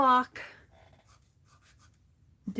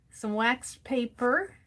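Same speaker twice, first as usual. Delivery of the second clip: normal talking voice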